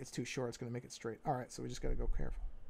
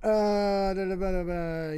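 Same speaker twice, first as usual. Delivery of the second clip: loud string of da-da-ba syllables, falling in pitch; loud vocal filler; 5 semitones higher, 9 dB louder, slower than usual. Real, not acted. Artist thinking about the next step, loud nonsense filler. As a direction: stall out loud with nonsense syllables, starting high.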